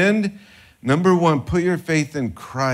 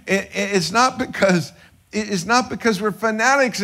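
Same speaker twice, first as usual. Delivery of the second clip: ordinary talk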